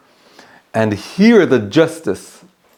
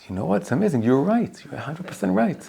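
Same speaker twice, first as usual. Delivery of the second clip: hushed, gentle agreement, much softer; soft agreeing rabbi; 6 semitones higher, 8 dB quieter than usual. Retold or imitated. Rabbi quietly soothing the first litigant, hushed and gentle. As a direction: soothe the man, you're right. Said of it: "the rabbi listens and says".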